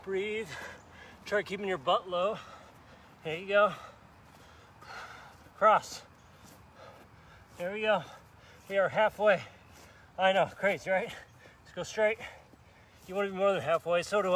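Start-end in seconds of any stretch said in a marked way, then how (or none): none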